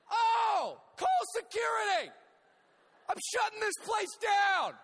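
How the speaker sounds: high, loud screams of panic; panicked shouting. Retold or imitated. Horrified spectator yelling at the bullring, panicked shouting. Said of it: "as I'm shouting".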